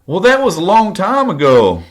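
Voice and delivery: high, loud mock-indignant hillbilly woman. Stepmother answering his accusation in public, indignant mimicry. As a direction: protest shrilly in mock outrage. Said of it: "she looked at me indignantly and said".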